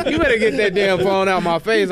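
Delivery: comic heated outburst; mock angry warning. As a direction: act out a fed-up demand. Retold warning to pushy lady.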